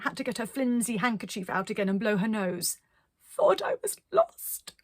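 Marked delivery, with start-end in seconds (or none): 3.2-4.7 high, slow, weepy voice; tearful gurgle. Acted. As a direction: gurgle through tears. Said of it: "she gurgled".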